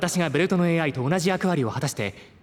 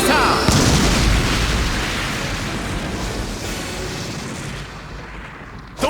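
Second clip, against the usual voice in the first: much higher, loud, echoing device call; finisher announcement; 7 semitones higher, 4 dB louder, much slower than usual. Acted. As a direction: announce the finishing move with the final word stretched out. Belt announces the finishing move. (必殺技を告げるベルトの機械音声)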